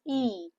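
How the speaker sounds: "E" held as a long ee; long vowel. Shown as a demonstration. Teacher contrasting long and short vowels, "E" held long. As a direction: draw "E" out into a slow, long vowel. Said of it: "the long vowel".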